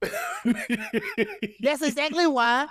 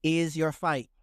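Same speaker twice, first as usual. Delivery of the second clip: flat reading of lyrics; 5 semitones lower than usual. Pretend. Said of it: "that ain't how you said it".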